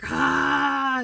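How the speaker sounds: loud high roaring breath; dragon roar. Retold or imitated. Showing viewers her dragon rage, a loud roar. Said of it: "I felt like a dragon breathing".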